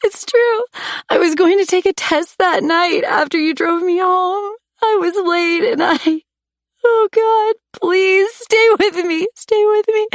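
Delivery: very high crying begging; sobbing plea. A narrator's reading. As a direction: plead through sobs. Heroine begs dying lover.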